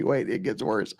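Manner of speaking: normal talking voice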